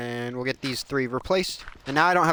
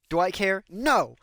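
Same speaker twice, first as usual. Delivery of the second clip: mock aggressive; 7 semitones higher than usual. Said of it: "Am I being a little aggressive?".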